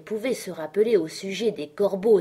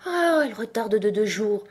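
sighed-out, higher character voice; sighing complaint; 4 semitones higher, 4 dB louder than usual. A narrator's reading. Hatter finds his watch is wrong, sighing. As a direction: sigh over the bad news. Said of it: "murmura le chapelier en soupirant".

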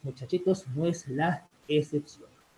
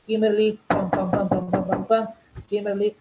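loud, higher call with spoken knocking; calling at the door; 4 semitones higher, 7 dB louder than usual. Acted. Boyfriend at Kimberly's house inviting her out, calling at the door.

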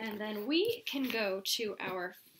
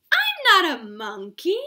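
mock indignant; 5 semitones higher, 11 dB louder than usual. Protesting the book's words.

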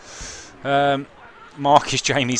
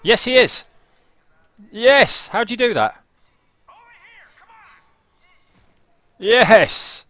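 much higher, louder triumphant shouts; excited yells; 12 semitones higher, 9 dB louder, slower than usual. Real, not acted. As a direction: cheer like you just scored. Celebrating a win in game.

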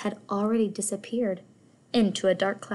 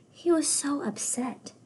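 whispered sadness; 6 semitones higher than usual. A narrator's reading. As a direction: hush it with sympathy. Narrator hushes sad moment.

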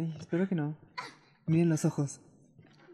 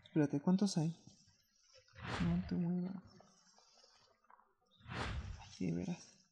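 hushed; 10 dB quieter than usual. Real, not acted. Hiding from enemy, stealth.

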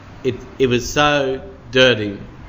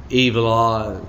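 usual speaking voice

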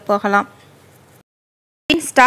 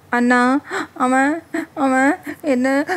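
softer, slow and halting, a touch of tears; mild sobbing question; sobbing softly; 3 semitones higher, much slower than usual. A narrator's reading. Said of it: "தேம்பி தேம்பி அழறா". (லேசான விம்மலுடன் மெதுவாக)

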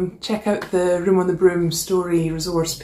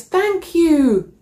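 high, loud happy thanks; grateful cry; 12 semitones higher, 6 dB louder than usual. Acted. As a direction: burst out with relief. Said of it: "The witch cries".

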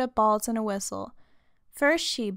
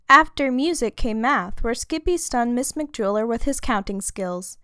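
ordinary delivery, nothing marked

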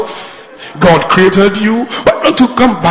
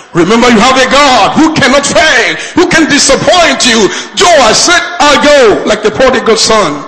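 raised, driving sermon delivery; preaching; 5 semitones higher than usual. Acted. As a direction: preach it with rising urgency. Preaches at full force.

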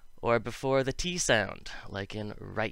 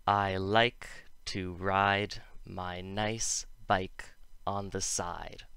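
slow, careful model sentence; slow demo; slower than usual. Shown as a demonstration. Teacher slowly models a practice sentence. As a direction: spell out each word slowly. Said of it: "here's an example to try".

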